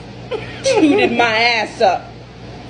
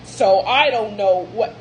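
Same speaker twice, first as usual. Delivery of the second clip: ordinary talk, nothing marked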